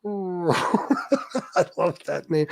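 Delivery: slow, laughing through the words; real laugh over the name. Real, not acted. Laughs at a funny username.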